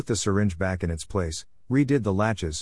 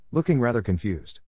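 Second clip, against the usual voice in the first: ordinary delivery, nothing marked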